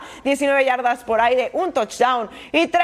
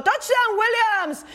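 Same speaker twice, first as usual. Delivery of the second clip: touchdown call; 6 semitones higher, slower than usual. Acted. Play-by-play touchdown highlight.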